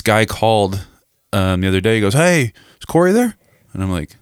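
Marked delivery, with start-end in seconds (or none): 2.1-3.3 high, over-friendly phone salesman; chirpy caller. Retold or imitated. Car salesman phoning a customer, put-on high friendly voice.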